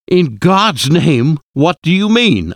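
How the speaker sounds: doctor pleading in horror with the professor; horrified plea. A narrator's reading. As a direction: drag out the first words in dread, then demand the answer. Horrified doctor begs an answer.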